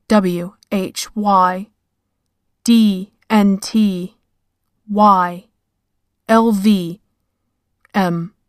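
each letter spoken slowly, apart; slow spelled letters. Acted. Spelling out a spirit message.

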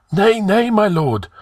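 high-pitched anxious protest; trembling. A narrator's reading. Trembling high priest placating the angry king, anxious. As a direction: plead high and hurried in manner. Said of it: "The high priest was trembling".

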